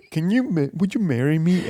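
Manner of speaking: proposal lifted high, with a hint of Indian accent; accidental Indian accent. Man retells his proposal to girlfriend, slipping into an Indian accent. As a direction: ask it haltingly in a light, high Indian-sounding accent.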